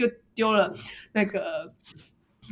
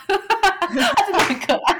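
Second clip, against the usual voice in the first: high, louder words through giggles; laughing fondly; 11 semitones higher, 5 dB louder than usual. Real, not acted. Praising the cute younger coworker, laughing fondly.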